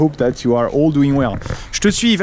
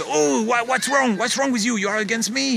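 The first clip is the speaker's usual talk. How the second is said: higher-pitched offended protest; mock indignation; 6 semitones higher than usual. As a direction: act out feeling personally attacked.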